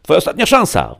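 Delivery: loud, high-pitched ultimatum; police at the end of their patience; shouted final warning. A narrator's reading. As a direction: yell it through a locked door.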